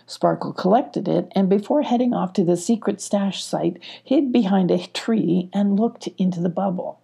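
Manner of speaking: ordinary delivery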